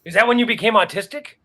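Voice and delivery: loud, fast mock question; teasing jab. Real, not acted. Guest teasing the storyteller about vaccines. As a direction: needle a friend for a laugh.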